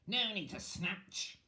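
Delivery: high, slow witch voice; high witch cry. Acted. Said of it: "cried the witch".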